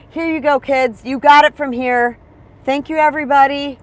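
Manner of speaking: bright, loud send-off, pitch well up; a happy last goodbye to the kids; joyful farewell. Pretend. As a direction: wave goodbye to everyone with delight. Imagined farewell at death's door.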